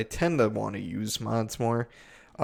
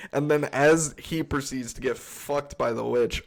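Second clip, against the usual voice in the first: usual speaking voice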